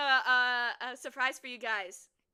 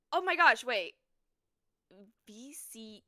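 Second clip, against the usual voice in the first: ordinary talk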